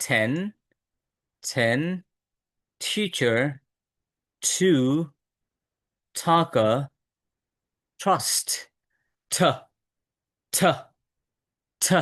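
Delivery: "ten, teacher, two, taka" one by one with an aspirated t; tongue tip at the upper-tooth ridge for each t in "ten, trust, th"; t sound. Shown as a demonstration. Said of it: "জিহ্বার সামনের অংশটা".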